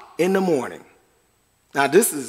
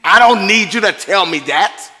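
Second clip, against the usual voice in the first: mock angry retort; 3 dB louder than usual. Believer snapping back at friend quoting scripture, mock angry. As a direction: play the touchy believer who knows it already. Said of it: "You get mad at them".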